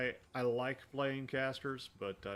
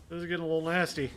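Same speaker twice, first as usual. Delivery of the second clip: tense; 6 semitones higher, 8 dB louder, much faster than usual. Real, not acted. Tense moment in combat.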